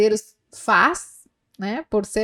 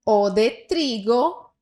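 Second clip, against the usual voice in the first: slow dictation; slower than usual. Shown as a demonstration. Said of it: "slow reading for repetition".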